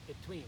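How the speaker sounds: "Between" stressed on its second syllable, be-TWEEN. Shown as a demonstration. Teacher models for learners, "Between" stressed on "tween". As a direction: lift the "tween" of "Between", then fall. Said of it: "accent is on the second syllable".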